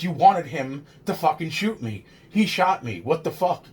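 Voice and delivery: retold yell. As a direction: act out an indignant yell at the cop, without full volume. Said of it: "starts to fucking yell at the fucking other cop".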